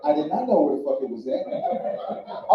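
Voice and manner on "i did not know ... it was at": ordinary delivery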